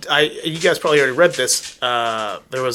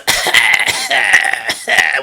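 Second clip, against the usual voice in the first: loud imitation of the Smoker's audio cue; imitated Smoker noise; 6 semitones higher, 5 dB louder than usual. Player showing friends the Smoker's sound, loud mimicked noise. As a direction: mimic the zombie's call in loud bursts. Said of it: "like a goddamn smoker".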